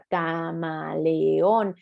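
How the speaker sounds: "camaleón" stretched very slowly, every syllable apart. Shown as a demonstration. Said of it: "tiene cuatro sílabas". ("camaleón" muy despacio, sílaba por sílaba)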